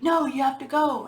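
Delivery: put-on a mother telling a sick child to go to school, loud; retold yell. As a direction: refuse them firmly, raising the voice. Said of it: "and be yelling at them".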